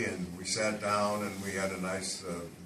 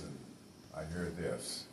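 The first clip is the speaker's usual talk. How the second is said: normal talking voice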